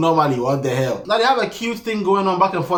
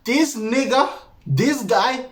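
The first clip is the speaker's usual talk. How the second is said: high, rising incredulity; can't believe this man; exasperated disbelief; 7 semitones higher, slower than usual. Real, not acted. Exasperated at contestant.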